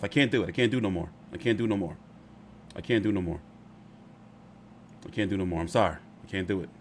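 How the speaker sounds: exhausted soft surrender; worn-out mutter. Real, not acted. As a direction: give up, trailing off quietly.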